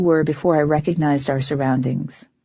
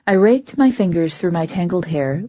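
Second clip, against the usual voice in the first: ordinary delivery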